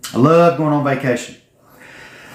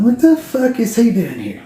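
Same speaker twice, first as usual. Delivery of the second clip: inner disbelief; 7 semitones higher than usual. Retold or imitated. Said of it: "I wasn't saying this out loud".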